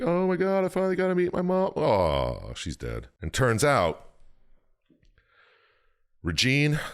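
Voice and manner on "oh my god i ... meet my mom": much higher, fast, hammed-up gush; mock dying joy. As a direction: gush it out as a fake tearful daughter. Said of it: "being like".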